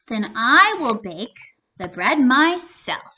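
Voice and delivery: loud, firm resolve; determined hen. Acted. Hen to friends, loud firm resolve. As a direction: say it like she'll do it herself.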